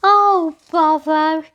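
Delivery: high, loud exclamation; a hurried exasperated cry; startled "oh, bother!" blurted out; exclaimed. A narrator's reading. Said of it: "she exclaimed hurriedly".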